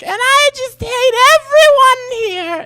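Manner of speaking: very high, slow mock whine; whiny drunk complaint. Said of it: "Guinevere is like".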